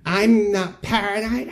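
high, slow, tight denial of "paranoid"; high slow insistent denial. Retold or imitated. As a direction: deny it through clenched calm. Nancy denying being paranoid.

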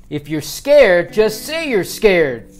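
slow, loud, high coach's yell; drawn-out rallying shout; repeated shouted challenge. A narrator's reading. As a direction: shout it slowly to fire up the team. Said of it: "Connor repeated".

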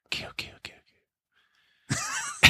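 breathless horror-movie whisper; creepy whisper. Pretend. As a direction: whisper it like a slasher soundtrack.

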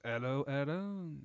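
put-on slow, drawn-out French-accented greeting; weird French accent. Giant French waiter greeting the player, weird French accent. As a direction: draw it out in an odd French accent. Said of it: "he has a weird French accent".

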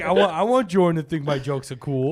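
loud, high, mocking whiny voice; mocking impression. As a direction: whine it like a needy kid. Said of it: "oh, he's like".

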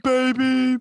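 high mock echo. Co-host echoes "baby" mockingly.

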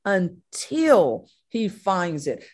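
slow, drawn-out "until he finds it"; stress on until. Shown as a demonstration. Preacher hammering "until" to listeners, slowly. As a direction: let "until" land slow and heavy. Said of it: "the emphasis on that word".

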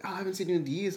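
high-pitched surprised greeting; acted surprise. To someone thought dead, acted surprise. Unexpected meeting, acted retelling.

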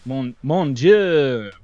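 drawn-out French dismay; French accent. Pretend. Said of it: "is what I say".

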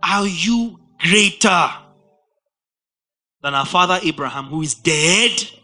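loud, slow, indignant demand; scornful challenge. Acted. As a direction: drag the question out, loud and scornful.

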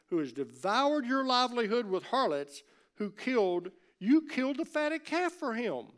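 loud, high, accusing outburst; indignant older son. Acted. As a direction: throw the brother's sins in his face.

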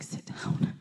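unvoiced whisper, firm but merciful; whispered order. Teacher quietly telling the student to sit down, whispered. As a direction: hush the order out without making a scene. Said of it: "He's just like".

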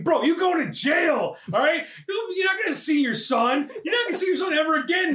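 high, incredulous telling-off; mock scolding. Narrator scolding a man headed to jail, high and incredulous. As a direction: hammer home the bad news with mock outrage.

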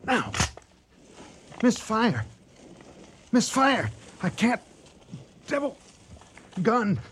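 high, loud frantic yells; panicked shouting. Acted. Hunter screaming at the devil as his rifle misfires, panicked shouting. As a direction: scream it as the gun fails you.

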